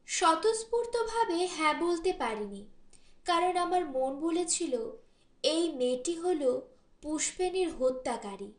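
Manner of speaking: plain reading voice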